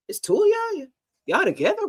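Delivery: high-pitched imitation of astonished people; mock surprise. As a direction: pitch it up in playful shock.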